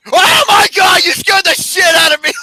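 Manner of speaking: high, loud startled shriek imitating her; mock scream. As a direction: shriek it like she just got scared. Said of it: "she goes".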